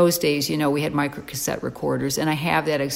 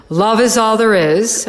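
loud, raised retold divine voice; booming retold message; loud proclamation; 4 semitones higher, 7 dB louder than usual. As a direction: proclaim it loudly as a revelation. Retelling God's proclamation from above.

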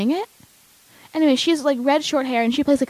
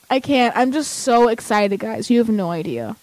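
normal talking voice

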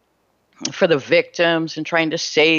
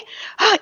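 a sharp, high gasp of alarm; mother gasping on seeing her daughter upset; shocked gasp. Retold or imitated. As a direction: suck in breath in surprise. Family shocked at her state.

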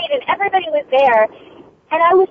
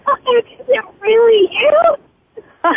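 thrilled disbelief; 8 semitones higher, much slower than usual. Real, not acted. Surprise reunion on live call.

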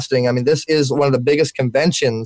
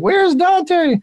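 much higher, playful voice of impatient fans; imitating fans. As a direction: toss it up high like a chorus of viewers. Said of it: "everybody on the Facebook Live is like".

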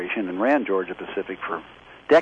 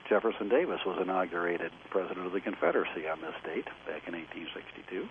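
faint, fading phone voice; 3 semitones lower, 11 dB quieter than usual. Real, not acted. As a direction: voice dropping away on the phone line. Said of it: "your voice is fading out in a sort of cyclical way".

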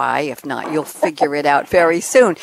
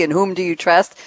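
ordinary talk

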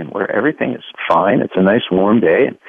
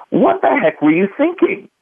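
exasperated question; 12 semitones higher than usual. Real, not acted. Asking his father rhetorically.

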